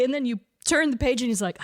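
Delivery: ordinary delivery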